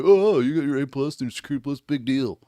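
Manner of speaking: mock a scornful naysayer; put-on belittling of someone's achievement; dismissive mockery. Streamer imitates people sneering at certifications, dismissive mockery. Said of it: "like, poo-poo".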